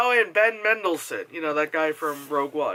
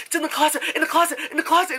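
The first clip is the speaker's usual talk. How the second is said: fast, high repeated warning; rapid high-pitched chatter; mimicked nervous moviegoer; 9 semitones higher, much faster than usual. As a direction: imitate someone anxiously calling out scares. Imitating man chattering during movie.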